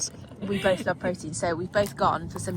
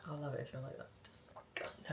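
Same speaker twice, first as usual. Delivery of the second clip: whispered; 5 semitones lower, 16 dB quieter than usual. Pretend. Imagined viewer reaction, whispered.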